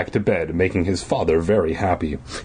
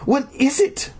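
high-pitched acted demand. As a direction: squeal the question impatiently. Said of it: "Ivy demanded".

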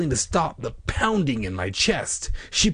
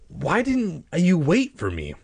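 high, drawn-out indignant question; mildly angry, pitched up; angry demand; 7 semitones higher than usual. A narrator's reading. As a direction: demand an answer with acted annoyance. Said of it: "I demanded angrily".

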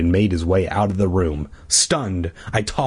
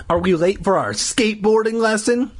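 put-on girl's voice; 11 semitones higher than usual. A narrator's reading. Caitlin speaks to Gary.

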